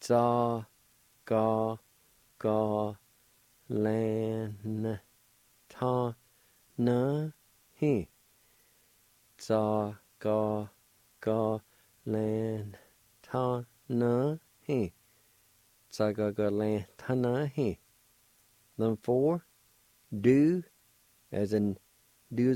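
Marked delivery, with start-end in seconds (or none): 0.0-8.1 "Za, ga, ga, lan, ta, na, he" in long separate syllables, lan nasalized; syllable-by-syllable demo. Shown as a demonstration. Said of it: "it's pronounced like this". 9.4-14.9 syllable-by-syllable demo. Shown as a demonstration. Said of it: "it's pronounced like this". 15.9-17.8 joined-up pronunciation. Shown as a demonstration. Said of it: "it's pronounced like this".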